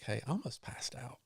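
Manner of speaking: hushed, unvoiced confession; whisper. Runner quietly telling crew of nearly fainting, whispered. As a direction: whisper it over like a private admission. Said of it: "I just whispered over to Jennifer".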